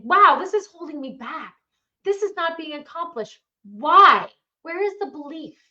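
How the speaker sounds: loud, fed-up self-questioning; mock annoyed. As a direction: demand answers from yourself. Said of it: "You getting mad about something".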